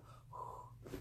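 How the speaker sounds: long, airy blown-out sigh; climax exhale. Acted. Lovers reaching the peak during sex, soft sighed exhale. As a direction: let out a soft breathy whoosh. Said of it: "we're having sex and we go".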